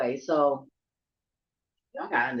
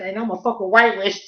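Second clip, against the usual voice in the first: louder mock-menacing declaration; scary threat; 5 semitones higher, 10 dB louder than usual. Scaring the shrimp thieves, loud mock-menacing threat. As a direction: threaten them like a witch. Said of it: "to scare people".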